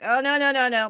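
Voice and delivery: teasing correction; mock correcting. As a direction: stop the niece from spelling it wrong. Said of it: "we was like".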